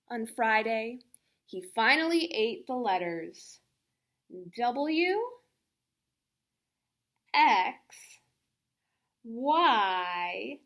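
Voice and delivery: ordinary delivery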